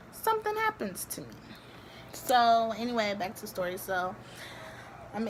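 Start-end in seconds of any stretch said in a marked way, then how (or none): 0.1-1.3 self-pitying whine. Pretend. Complaining about bad luck alone.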